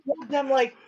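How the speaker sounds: high voice, laughing through it. Acted. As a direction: squeak it out while laughing. NPC receptionist voiced, laughing.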